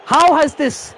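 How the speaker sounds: high, loud spluttering disbelief; real incredulous fury, breaking off mid-question; outraged shouting. Real, not acted. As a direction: shout slowly, too outraged to finish. Spluttering at second goal conceded.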